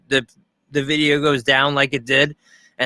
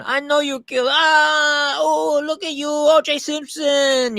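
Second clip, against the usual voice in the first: very high, drawn-out put-on maid voice; hammed-up frightened maid; 14 semitones higher, slower than usual.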